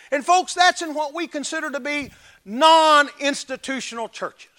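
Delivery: loud, high, driving sermon delivery; fiery preaching. Acted. Preacher pounding the point home to his congregation, at full force. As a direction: preach it hard to the pews.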